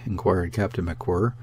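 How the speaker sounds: plain reading voice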